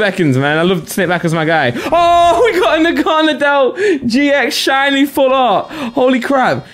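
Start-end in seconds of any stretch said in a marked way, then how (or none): 1.7-6.7 high, drawn-out gleeful outburst; excited. Real, not acted. Streamer pulls a shiny Naganadel, excited. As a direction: exclaim thrilled at a rare find. Reacting to rare card pull.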